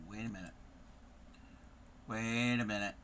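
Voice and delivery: ordinary talk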